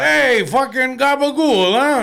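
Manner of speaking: mock Italian-American accent. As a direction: play a mock mobster. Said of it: "you know what I'm talking about".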